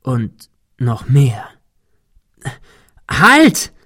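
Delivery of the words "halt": much higher, loud piercing cry; shrill scream. A narrator's reading. Thomas screaming at Cecil to stop, shrill. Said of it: "schrie er schrill".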